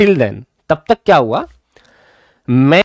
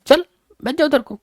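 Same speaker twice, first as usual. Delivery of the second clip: put-on higher, doting teacher voice coaxing a child; kindly teacher; 5 semitones higher than usual. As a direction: pamper the boy like a fond elder. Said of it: "kindly बोला।". (ऊँची, लाड़ भरी आवाज़ में बच्चे को पुचकारते हुए)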